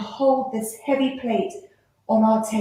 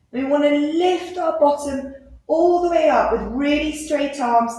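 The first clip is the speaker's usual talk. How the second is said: ordinary delivery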